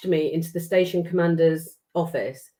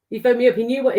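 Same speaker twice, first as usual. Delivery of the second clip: ordinary delivery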